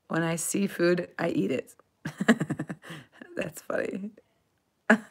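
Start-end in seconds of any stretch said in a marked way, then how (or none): 2.0-3.0 chuckling at a joke; amused laugh. Real, not acted. Reacts to a viewer's joke.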